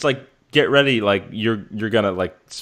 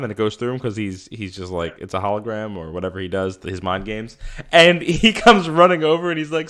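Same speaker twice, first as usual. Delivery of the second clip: ordinary talk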